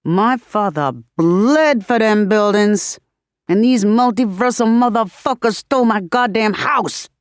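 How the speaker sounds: incensed tirade, politician polish gone; angry MC outburst. A narrator's reading. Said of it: "hears M C Free in her anger".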